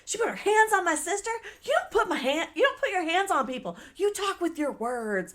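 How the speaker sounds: high-pitched, worked-up retold complaint; indignant complaint. Acting out her complaint to the security guard, indignant. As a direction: plead the case in mock outrage.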